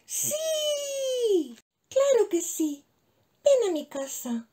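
high, eager, joyful yes; delighted little mouse. Acted. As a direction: burst out gladly with the invitation. Happy mouse accepts friendship.

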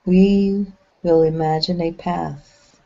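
unhurried guide voice with long pauses between phrases; measured, spacious phrases, leaving silence to settle; guided meditation. Acted. Channeling a meditation for listeners relaxing along a path, guided meditation.

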